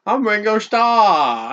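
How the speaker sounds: slow, high mock British accent. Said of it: "I do the same accent for every country".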